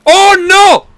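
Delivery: loud, high cry of alarm; high, startled yell; alarmed shout. Real, not acted. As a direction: shout it out in sudden dismay. Sudden alarm during play.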